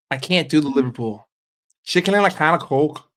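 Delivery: usual speaking voice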